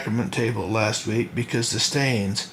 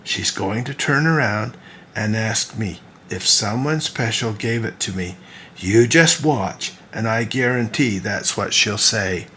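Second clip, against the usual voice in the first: ordinary delivery, nothing marked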